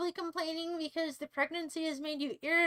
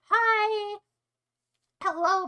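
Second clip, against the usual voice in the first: excited greeting; 6 semitones higher, 7 dB louder, much slower than usual. Real, not acted. Greeting a new viewer brightly.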